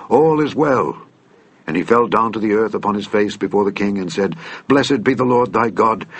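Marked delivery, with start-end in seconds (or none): 0.1-1.0 higher-pitched herald's call. A narrator's reading. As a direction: call good news to the king. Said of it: "Ahimaaz called and said unto the king".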